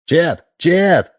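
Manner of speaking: urgent shout across a crowd; shouting a name. A narrator's reading. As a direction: yell for the constable, rising each time. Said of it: "кто-то закричал".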